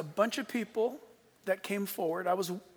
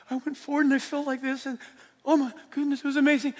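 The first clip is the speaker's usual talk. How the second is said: higher, louder, gushing testimony voice; gushing new believer; 6 semitones higher, 6 dB louder, faster than usual. Retold or imitated. Preacher mimicking new converts gushing in the prayer room. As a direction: gush like a thrilled convert. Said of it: "saying about how they feel".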